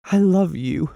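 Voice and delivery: woman cries out her love; crying out. A narrator's reading. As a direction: fling it out desperately. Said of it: "she cried".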